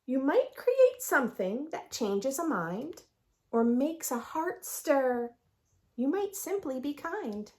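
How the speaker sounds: lively sing-song picture book read; reading to children. A narrator's reading. Teacher reads the rhyming book to her class. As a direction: read the rhymes aloud with bounce.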